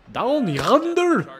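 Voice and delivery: high, loud, drawn-out holler; playful holler. Acted. Player hollering the pitch location in jest, playful holler. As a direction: belt it out with a big swoop in pitch. Playful exaggerated repeat, much louder.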